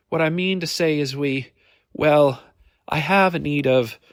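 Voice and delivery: a lady feeling for her words; hesitant lady. Acted. Nervous lady client speaks.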